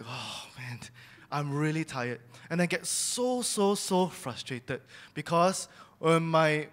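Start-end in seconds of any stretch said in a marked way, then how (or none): 0.0-2.2 weary complaint. Retold or imitated. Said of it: "I'm like".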